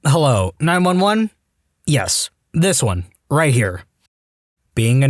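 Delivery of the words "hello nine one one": plain reading voice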